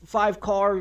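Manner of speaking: normal talking voice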